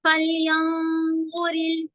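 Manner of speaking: फलयंजुरिल drawn slow with a heavy nun. Shown as a demonstration. Teacher demonstrating the heavy nun, slow. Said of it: "नून भी heavy हो जाएगा".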